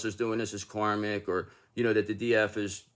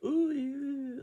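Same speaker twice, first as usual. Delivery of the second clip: very high, breathy put-on sweetness; mock sugary voice; 4 dB quieter than usual. Mocking the sweet-talking women, high and sugary. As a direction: send up the overly nice act. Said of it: "we don't talk like that".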